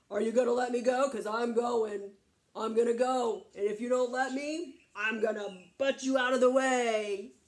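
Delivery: loud, forceful goat threat; defiant big goat. Acted. Biggest goat threatening to butt the troll, forceful. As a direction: insist loudly, ready to charge.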